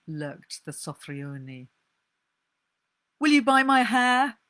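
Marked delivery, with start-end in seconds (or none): 3.2-4.4 high and loud, desperate; urgent loud plea. Acted. Della pleads loudly with the hair buyer. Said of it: "asked Della".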